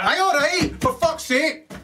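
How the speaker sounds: sudden high-pitched fed-up interjection; mock exasperation. Storyteller interrupting his own song, mock exasperation.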